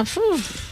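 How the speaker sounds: high tired puff of breath; exasperated uf; weary huff. Retold or imitated. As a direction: blow out in exhaustion. Exhausted after two-hour consultation.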